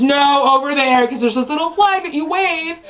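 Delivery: loud, high bossy instruction; bossy mom. Retold or imitated. Mom directing her daughter to the flag, loud. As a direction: order her over to the flag. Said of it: "my mom goes".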